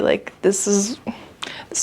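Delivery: soft emotional whisper; whispered thanks to her viewers, moved. Real, not acted. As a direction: breathe it out, touched.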